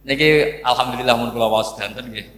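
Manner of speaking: a relieved little laugh into the words. Real, not acted. Preacher laughing at his own polite style before the congregation.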